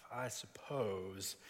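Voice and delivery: slow, grudging concession, drawn out; reluctant admission. Acted. Simon answering Jesus's trap question, reluctant and drawn out. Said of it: "knows he's setting himself in a trap".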